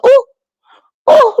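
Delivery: very high, loud mock gasp; shocked. Streamer reacting to an outburst with a hammed-up squeal. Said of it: "I was like".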